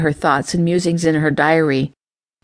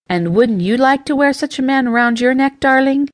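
playful higher teasing lilt; 7 semitones higher than usual. A narrator's reading. Mother teasing her eldest daughter about the man, light higher tease. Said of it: "Tempest teased her eldest daughter".

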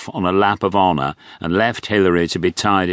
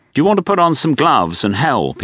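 lightly voiced upbeat doctor, higher; a hint of a breezy posh doctor; 8 semitones higher, 3 dB louder than usual. A narrator's reading. Doctor offering gloves.

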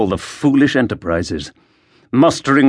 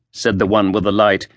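plain reading voice

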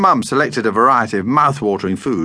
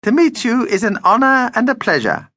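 very high, courtly flattery; gallant vampire; 13 semitones higher than usual. Acted. Vampire greets the shopkeeper's wife, gallant. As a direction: pour on the courtly charm, pitched high.

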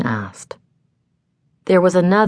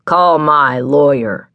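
slow, louder, throaty man's voice; deep-throated demand; 5 dB louder, slower than usual. A narrator's reading. Deep-throated man demanding his lawyer, slow and forceful. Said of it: "demanded a deep-throated man".

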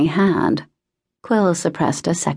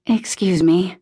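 ordinary delivery

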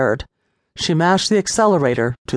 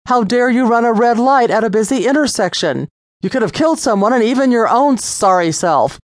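higher, louder scolding tirade; angry lecture; 6 semitones higher, 3 dB louder than usual. A narrator's reading. Officer scolding Lisa for endangering children, angry. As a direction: unload on her, stern. Officer has had enough.

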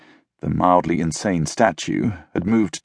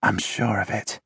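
softly murmured certainty; 6 dB quieter than usual. A narrator's reading. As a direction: keep it soft and confiding. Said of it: "she murmured".

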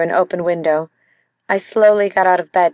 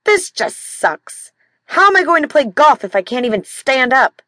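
whiny complaint; 6 semitones higher than usual. Acted. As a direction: sulk about the ruined golf. Injured husband complaining about golf.

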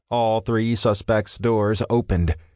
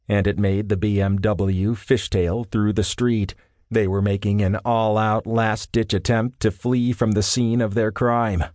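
ordinary delivery

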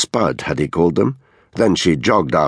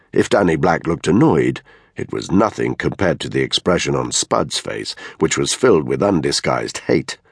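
ordinary delivery, nothing marked

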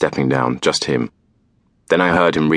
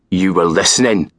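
hissed; 3 semitones higher than usual. A narrator's reading. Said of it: "he hissed".

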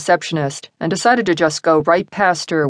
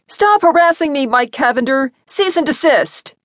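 yelling; 9 semitones higher than usual. A narrator's reading. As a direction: yell it at the machine. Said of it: "Sarah yelled into the intercom".

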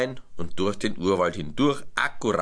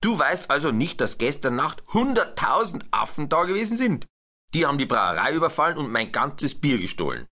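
higher, accusing brewmaster voice; indignant brewmaster; 7 semitones higher than usual. A narrator's reading. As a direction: confront the elephant as the angry brewmaster. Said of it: "sagte Herr Braumeister".

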